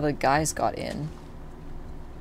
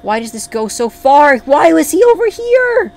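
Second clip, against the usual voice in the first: raised voice climbing into a high scream; real mounting anger; 10 semitones higher, 11 dB louder than usual. Real, not acted. Player asks why he was over here, mounting fury. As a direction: build to a furious high yell. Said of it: "Oh, I'm mad".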